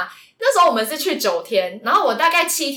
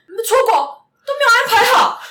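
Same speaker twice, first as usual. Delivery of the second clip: higher, louder imitation of a scolding boyfriend; mock scolding; 11 semitones higher, 6 dB louder than usual. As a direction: snap it like the annoyed boyfriend. Boyfriend scolds her, retold. (模仿男友凶人)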